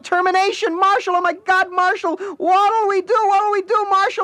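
piercing high scream of his name; screaming wife. Acted. Wife screaming for Marshall over his turn-off notice, panicked. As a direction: shriek in panic across the house. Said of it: "she screamed".

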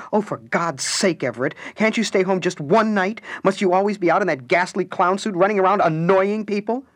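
high, loud exasperation; fed up, let it burst out; exasperated wife. Acted. Alice slamming her coffee down, exasperated at Everett. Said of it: "Alice slammed her coffee bulb down".

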